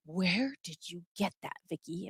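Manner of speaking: low-volume, probing mom voice; suspicious mother. Acted. Mom confronting daughter over the stolen letter, suspicious. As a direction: quietly question the child as mom.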